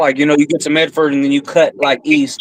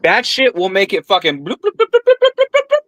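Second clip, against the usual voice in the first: playful bloops pitched much higher, rising; cartoon bubbling sound for fast growth; a run of high mock bloops; bloop noises; 7 semitones higher than usual. Mimics plants bursting into bud.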